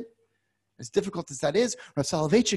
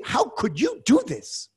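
slow, rising indignant cry, higher and building to a shout; accusing God; 7 semitones higher, much slower than usual. Acted. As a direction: demand it of God, voice climbing. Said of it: "a finger pointed directly at God and saying".

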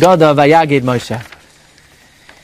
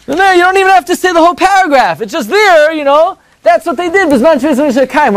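much higher, airy brush-off; breezy dismissive voice; 11 semitones higher than usual. Pretend. Teacher voices a casual rabbi, high and breezy. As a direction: wave the worry away, high and casual.

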